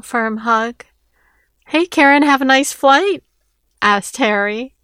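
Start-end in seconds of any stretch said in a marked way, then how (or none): 1.7-3.2 higher, louder wisecracking hello; teasing greeting. A narrator's reading. Brother-in-law greets the arriving guest, teasing. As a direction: needle her cheerfully, pitched up. Harry teases arriving Karen.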